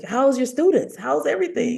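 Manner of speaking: high bright mom voice; imitating mom. Daughter imitates her mother asking kindly in a high voice. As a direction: ask it high like a doting mom.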